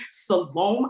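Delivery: "Salome" said as suh-LOME, long o; mispronounced name. Retold or imitated. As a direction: imitate the teachers' anglicised "Salome", rhymes with home. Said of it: "a name that's not mine".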